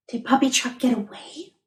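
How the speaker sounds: soft unvoiced whisper. Wondering aloud to the child, whispered. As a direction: whisper it with worry. Worried hushed question.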